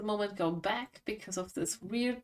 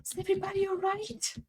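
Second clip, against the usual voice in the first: mock yell; 11 semitones higher, 4 dB quieter than usual. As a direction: call out high, holding the volume back. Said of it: "I yell".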